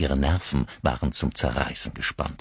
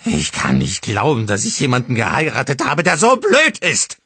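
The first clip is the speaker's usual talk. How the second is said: screaming, climbing far higher and louder; bellowing; 9 semitones higher, 8 dB louder than usual. A narrator's reading. Mother screams at husband in drunken rage. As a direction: roar contempt at your husband. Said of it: "brüllte sie".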